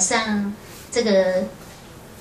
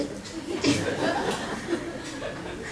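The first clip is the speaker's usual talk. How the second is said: breathy laugh; 6 semitones higher, 11 dB quieter than usual. Real, not acted. Laughs at being dumped there.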